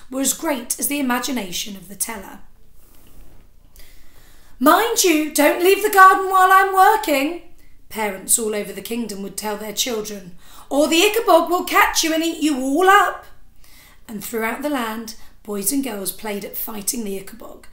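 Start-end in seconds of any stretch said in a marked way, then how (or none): 4.6-7.5 put-on loud, high warning call to a child; stern parental warning. Parent warning children in the garden, loud and sharp. 10.7-13.3 put-on loud, high warning call to a child; stern parental warning. Parent warning children in the garden, loud and sharp.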